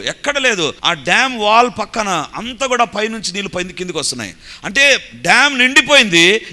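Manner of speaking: high, loud, fired-up sermon telling; preaching at full force. Acted.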